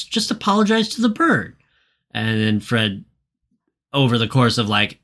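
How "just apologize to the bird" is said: high, put-on scolding voice; exasperated scolding. Friends tell Fred to apologize, high mock nagging. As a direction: nag him like a fed-up friend.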